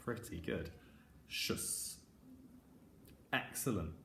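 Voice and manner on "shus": Shus, soft hissed; quiet Shus; sound to repeat. Shown as a demonstration. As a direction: say Shus for children to echo. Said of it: "Have a go at saying it after me".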